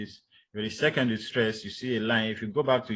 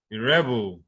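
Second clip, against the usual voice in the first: rebel as REB-el, falling away; stress on first syllable; 4 dB louder than usual. Shown as a demonstration. Teacher showing a word pair, rebel stressed on REB. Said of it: "stress that you could have".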